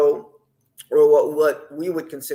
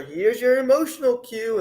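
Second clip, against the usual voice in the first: mocking writers; 9 semitones higher than usual. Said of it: "writers say".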